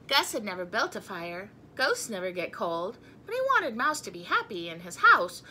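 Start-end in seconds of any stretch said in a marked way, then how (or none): none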